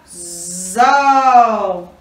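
ZÓ drawn out with an open O; syllable sound demo. Shown as a demonstration. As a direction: buzz into "Zó" and hold the vowel. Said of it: "juntos formam a sílaba".

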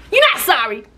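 high, loud snap back; sassy high comeback; indignant retort. Real, not acted. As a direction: call out the fake apology. Retorting to quoted apology.

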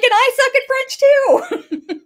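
high, loud and drawn out through laughter; laughing mock protest. Retelling Jackie admitting poor French, laughing mock wail. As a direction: wail it comically, cracking up.

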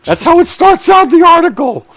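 high, loud burst of laughter; blurting it out mid-laugh; laughing loudly. Real, not acted.

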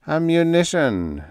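high, slow trance drone; loud, drawn-out psychic's voice; droning. A narrator's reading. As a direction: stretch it out as if entranced. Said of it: "droned Thaxton".

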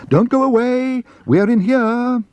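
high, sustained calling voice; high-pitched call across a room. A narrator's reading. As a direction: hail someone who is leaving. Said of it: "called Paddington".

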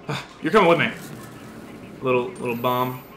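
ordinary talk

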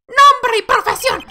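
high, loud, slow demand; demanding prosecutor. Acted. Prosecutor demands name from witness, loud and high. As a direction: stretch each word out loudly, high-pitched.

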